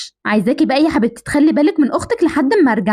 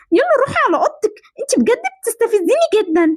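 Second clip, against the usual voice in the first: higher-pitched irritated outburst; exasperated scolding; 7 semitones higher than usual. Acted. Big sister blaming little sister for lost game, exasperated. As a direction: send the pest away, exasperated.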